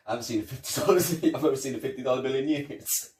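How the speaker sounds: laughing. Retold or imitated. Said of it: "And he goes".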